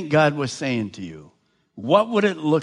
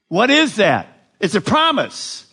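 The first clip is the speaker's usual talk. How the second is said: normal talking voice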